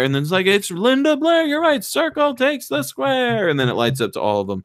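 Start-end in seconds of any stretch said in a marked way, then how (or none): 0.5-3.5 much higher, slow and showy host announcement; game-show host voice. Retold or imitated. Retelling the movie's Celebrity Squares host, hammed-up host.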